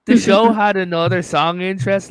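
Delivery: ordinary delivery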